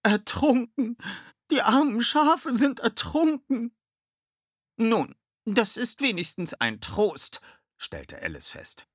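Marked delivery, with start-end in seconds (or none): 0.0-3.7 high, loud, slow wail; drawn-out tearful lament; sobbing. A narrator's reading. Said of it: "schluchzte sie". 4.8-7.4 slow, high character voice; lightly voiced character. A narrator's reading. Alice remarks on the apparent drownings.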